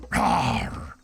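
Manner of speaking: low, loud mock lion roar. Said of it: "He was shouty and proud".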